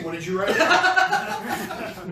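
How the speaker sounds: laughing. Real, not acted. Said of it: "laughing exchange".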